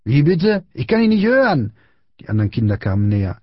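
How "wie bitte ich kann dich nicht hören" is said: high-pitched, taunting bully voice; mocking demand. A narrator's reading. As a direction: pretend you can't hear, tauntingly. Bully mocks the murmured apology.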